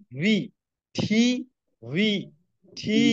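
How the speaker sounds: "ভি", "ঠি" one by one with pauses; pronunciation drill. Shown as a demonstration. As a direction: alternate "ভি" with airy V and "ঠি" with aspirated T. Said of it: "বলতে হবে".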